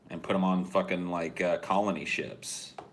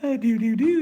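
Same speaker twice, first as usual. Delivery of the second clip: put-on mom cooing at baby; 11 dB louder than usual. Recorded mom cooing over her baby. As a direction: coo like a mother to her infant. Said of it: "a three D image of their mother going".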